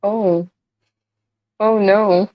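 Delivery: ordinary talk, nothing marked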